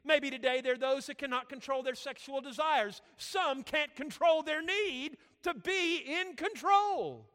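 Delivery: loud, high preacher delivery; forceful preaching. Real, not acted. Preacher urging the congregation about control, forceful. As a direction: preach it hard, stretching out the final words.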